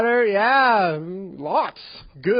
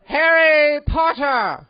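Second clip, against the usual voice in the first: loud roll call; 8 semitones higher, 8 dB louder, much slower than usual. Retold or imitated. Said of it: "I will now call attendance".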